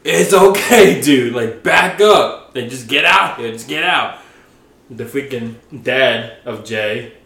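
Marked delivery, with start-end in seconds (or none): 0.0-4.2 loud, higher, urgent shouting; raised voice breaking up a fight; shouting to calm. Retold or imitated. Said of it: "I'm like".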